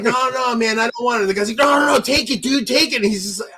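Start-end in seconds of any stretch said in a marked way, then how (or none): 0.0-1.3 higher-pitched mock protest; polite refusal, retold in a higher voice; reluctant refusal. 1.6-3.0 high-pitched, pushy imitation; imitating a guy who won't take no; insistent urging. Retold stranger pushing a gift.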